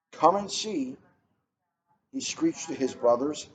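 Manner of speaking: gentle, even read-aloud pace; storybook read-aloud. A narrator's reading. Librarian reading a picture book to the room, storytelling voice.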